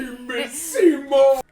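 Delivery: loud, slow bellow; plant monster shout. Acted. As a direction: belt it as the hungry plant. Quoting the plant.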